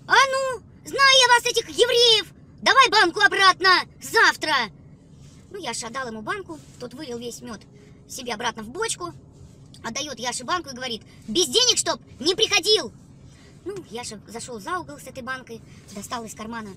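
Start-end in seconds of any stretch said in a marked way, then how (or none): 0.1-4.5 loud, high furious yelling; angry shout. Acted. Angry seller yells at boy. 11.3-12.9 loud, slow stern warning; stern shout. Acted. Seller sends boy away.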